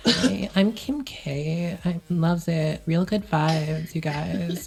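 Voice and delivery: put-on slow, drawn-out valley girl voice; lazy celebrity drawl, slowly; Kim Kardashian. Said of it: "so close to being Kim Kardashian".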